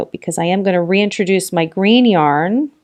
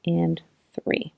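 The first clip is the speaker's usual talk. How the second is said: whispered count; 9 dB quieter than usual. Real, not acted. Counting stitches under her breath.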